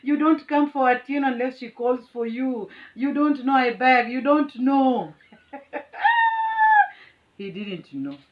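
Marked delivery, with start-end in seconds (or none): 0.0-5.1 fierce warning. Retold or imitated. Warning the driver off, loud defiance. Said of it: "And I'm like". 5.5-6.9 very high shrieking laughter. Real, not acted. Telling viewers the story, shrieking laugh.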